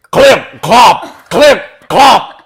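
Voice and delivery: very high, loud clip clop; clicking like horse hooves. A narrator's reading. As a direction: ring it out like hooves on wood. Hooves on the bridge.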